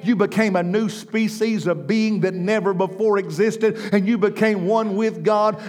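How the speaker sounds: loud, high, ringing sermon voice; full-force preaching. Acted. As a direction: preach it out to the crowd.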